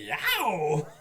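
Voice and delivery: loud, high startled yow; Frankie's yelp. Retold or imitated. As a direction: jump out of your skin. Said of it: "Frankie's like".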